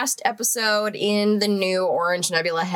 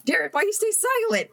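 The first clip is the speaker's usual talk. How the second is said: shout; 12 semitones higher than usual. Real, not acted. Group making loudest noises.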